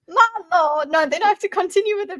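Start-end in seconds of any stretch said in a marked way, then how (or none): 0.1-0.9 higher, louder southern drawl; southern accent. Acted. Player trying out Lady Valette as southern, hammed-up accent. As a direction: try a ridiculous southern accent. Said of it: "can you do a southern accent?".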